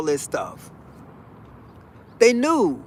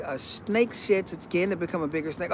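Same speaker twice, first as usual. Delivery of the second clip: hushed, softer mock-wise murmur; mock mystic murmur; 3 semitones lower, 10 dB quieter than usual. Kid mocks the saying, hushed and mock-mystic. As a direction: recite it like a spooky oracle. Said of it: "you're trying to sound like somebody from Star Wars".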